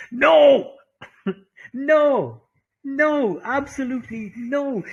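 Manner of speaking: loud, high laughing refusals; emphatic no. Real, not acted. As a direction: shout no with delight. Emphatic refusal to dropping accent.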